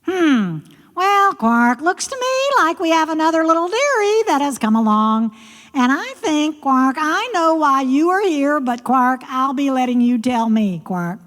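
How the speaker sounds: high-pitched, knowing frog voice; high frog voice, quark punctuating the lines; croaking frog character. Acted. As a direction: play a sly frog toying with a visitor.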